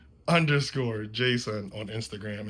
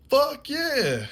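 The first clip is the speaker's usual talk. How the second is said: high, loud put-on yell of relief; eager yes; 6 dB louder than usual. Limping teen accepting the ride, high eager yell. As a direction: jump at the ride. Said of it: "And I was like".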